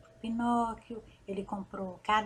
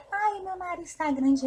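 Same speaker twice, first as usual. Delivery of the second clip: desperate; 7 semitones higher, 4 dB louder than usual. A narrator's reading. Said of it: "ficou desesperado".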